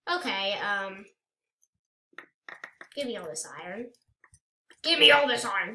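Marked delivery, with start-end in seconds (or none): 4.8-5.7 loud, greedy insistence; greedy demand. Pretend. Player grabs iron in the game, greedy demand. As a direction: demand it loudly, hungry for loot.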